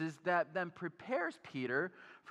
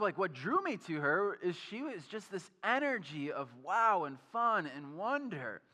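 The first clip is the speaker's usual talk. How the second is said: normal talking voice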